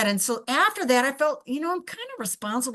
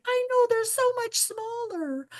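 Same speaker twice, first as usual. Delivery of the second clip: much higher, slow naive musing; mock client voice; 12 semitones higher, slower than usual. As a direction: voice the client's clueless thought. Client's thought mimicked.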